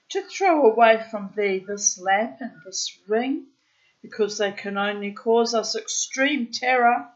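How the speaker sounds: put-on loud, deliberate read-aloud of an old tale; storybook reading. A narrator's reading. Reading an old tale aloud to the bird, deliberate storyteller voice. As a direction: read it out like an Arabian Nights storyteller.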